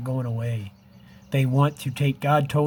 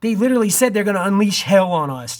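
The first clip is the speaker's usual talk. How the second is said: louder and higher, pressing the alarm; urgent raised voice; 6 semitones higher, 6 dB louder than usual. Real, not acted. Warning his viewers of coming doom, loud and urgent. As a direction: sound the alarm in earnest, raised and high.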